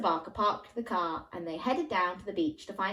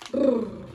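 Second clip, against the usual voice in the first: shivering brrr; 4 semitones higher, 7 dB louder than usual. Shown as a demonstration. Cold sea, shivering sound.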